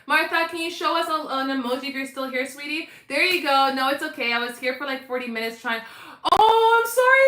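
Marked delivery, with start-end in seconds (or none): 6.3-7.3 high, loud upset apology; distressed apology. Real, not acted. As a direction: cry out sorry. Apologizes after missing her.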